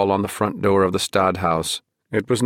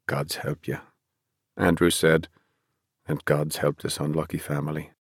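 hushed prayer; 4 semitones lower, 6 dB quieter than usual. Acted. Father's hushed blessing.

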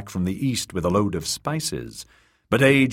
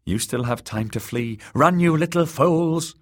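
urgent cry; 8 semitones higher than usual. Acted. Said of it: "the queen would have cried".

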